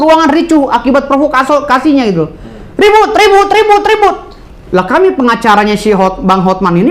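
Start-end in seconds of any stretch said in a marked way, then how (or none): 2.7-4.2 chaotic uproar. Retold or imitated. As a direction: voice jumps high, mimicking the commotion. Describing courtroom chaos. (meniru keributan dengan suara tinggi)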